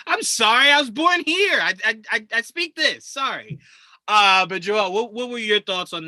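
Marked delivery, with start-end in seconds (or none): 0.1-3.6 higher, flustered, apologetic babble; mock flustered apology. Host imitating a flustered native speaker apologizing. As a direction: stammer an apology as if caught out.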